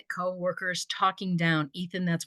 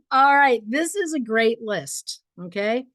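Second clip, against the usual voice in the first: ordinary delivery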